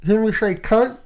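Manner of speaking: high cheeky line past a pen; pen in mouth. Real, not acted. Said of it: "I'll put my pen in my mouth".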